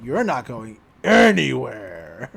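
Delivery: low, slow monster threat; menacing monster. Retold or imitated. Inhuman impostor stopping the boy from leaving, menacing.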